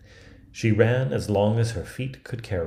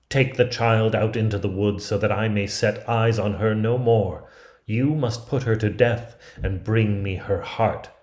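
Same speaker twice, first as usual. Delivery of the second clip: louder, imperious royal command; a wicked queen barking out her orders; 5 dB louder than usual. Acted. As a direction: order the huntsman to do the deed, loudly. Queen orders huntsman to kill.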